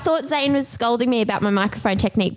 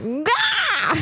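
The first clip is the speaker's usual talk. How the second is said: fiery shout; 12 semitones higher than usual. Pretend.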